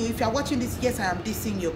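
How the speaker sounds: usual speaking voice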